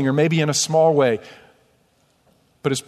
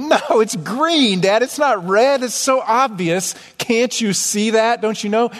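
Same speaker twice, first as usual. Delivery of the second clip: put-on high, insistent little girl voice, higher; daughter's voice; 6 semitones higher, slower than usual. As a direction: mimic a child correcting her dad, pitched up. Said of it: "She'll go".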